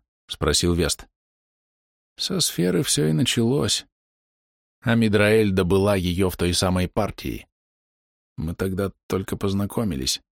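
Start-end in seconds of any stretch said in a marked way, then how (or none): 2.2-10.2 wounded archer reminiscing. A narrator's reading. Voices the dying archer.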